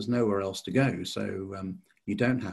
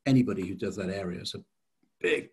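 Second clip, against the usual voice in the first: usual speaking voice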